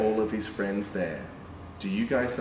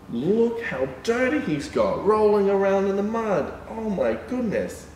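higher, playful shock; mock amazement; 8 semitones higher than usual. Showing children the muddy dog, mock amazed. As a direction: play up the shock for little ones.

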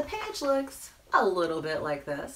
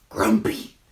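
low, abrupt comic grump; mock grumpy; 8 semitones lower, 6 dB louder than usual. Storyteller voicing the still-grumpy penguin, mock grumpy. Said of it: "But he was still".

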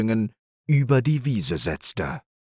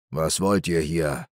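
lower, stern stag; 4 semitones lower than usual. A narrator's reading. The great stag asks the young does sternly, lower. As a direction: question them sternly from above. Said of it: "fragte eine strenge Stimme".

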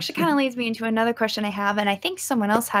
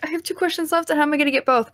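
mock worried; 6 semitones higher, faster than usual. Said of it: "I was like".

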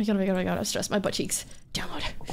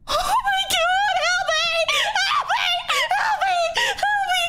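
panicked screaming; 13 semitones higher than usual. Real, not acted. Player begging teammate to save her, screaming. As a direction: shriek for help in real panic.

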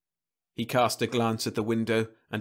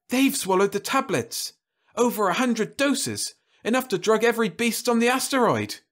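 excited realization; 11 semitones higher, 4 dB louder than usual. Acted. Wife realizes beasts took drug.

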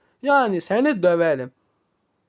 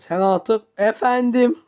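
ordinary talk, nothing marked

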